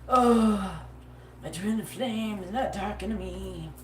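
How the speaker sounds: slow, mopey imitation of a lovelorn girl, not her own sound; mock the sulking lover pining by the phone; mopey lovesick mock. Reader mocks a client pining for her twin flame, mopey. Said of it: "Sorry, that's not even nothing I sound, you sound like".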